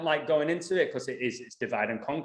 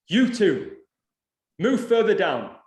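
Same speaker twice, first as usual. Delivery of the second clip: raised, louder barked order; a stranger ordering them to move away; retold order; 5 semitones higher, 6 dB louder, slower than usual. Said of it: "And he said to us".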